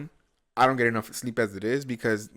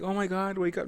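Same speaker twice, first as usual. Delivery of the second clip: higher, sweetly gentle wake-up call; mock gentle; 9 semitones higher than usual. Waking the dog gently in mock higher tone. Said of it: "like, gentle, like".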